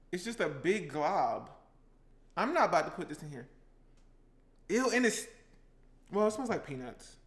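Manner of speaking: ordinary delivery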